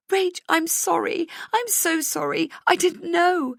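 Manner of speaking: high, tearful wail. A narrator's reading. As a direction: cry out the apology. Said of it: "she wails".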